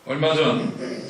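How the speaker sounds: usual speaking voice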